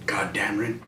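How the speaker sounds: exasperated whispered aside. Real, not acted. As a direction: breathe it under your breath.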